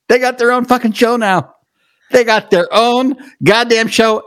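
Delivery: proud, emphatic brag; emphatic hype. Acted. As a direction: crow that they got their own show. Hyping guests' new show.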